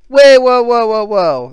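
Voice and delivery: loud, high whoa of alarm; startled protest. Real, not acted. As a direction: stop her mid-sentence. Protesting the million count.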